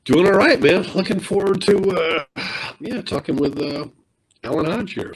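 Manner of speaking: crackly, clicking microphone sound; clicking mic. Real, not acted. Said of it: "your mic is, uh, clicking".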